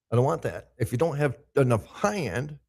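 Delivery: ordinary delivery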